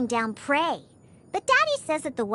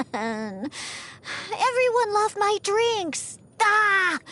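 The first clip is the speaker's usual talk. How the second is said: frustrated wail; 6 semitones higher, much slower than usual. Acted. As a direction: bemoan a failed plan, dragging it out. Wails that her drinks were loved.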